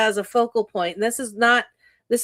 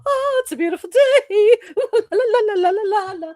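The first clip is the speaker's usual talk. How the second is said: much higher, louder, sing-song la la with laughs; mock morning person; 12 semitones higher, 5 dB louder than usual. She mocks a perky morning person springing out of bed. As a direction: ham up an overly cheerful morning person. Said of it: "spring out of bed and go".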